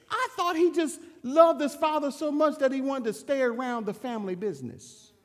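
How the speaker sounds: usual speaking voice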